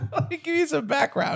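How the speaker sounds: words laughed out through giggles; laughing. Real, not acted. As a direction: say it while still cracking up. Laughing start of story.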